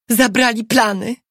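high, urgent exclamation; alarmed woman's cry in narration. A narrator's reading. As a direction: call out the alarm, pitched well up. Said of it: "wykrzyknęła".

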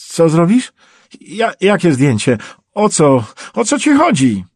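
high, loud, stammering alarm; panicked. Acted. Wounded captive alarmed by the stranger's photo, panicking. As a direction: fire frightened questions, voice climbing high. Trapped man panics at threat.

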